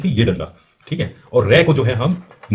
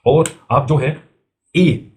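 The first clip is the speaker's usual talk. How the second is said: ordinary talk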